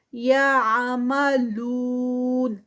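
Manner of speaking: slow, drawn-out tajweed recitation; Quranic recitation. Shown as a demonstration. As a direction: chant it slowly, stretching each sound. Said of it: "four counts کا stretch". (آہستہ کھینچ کر تلاوت)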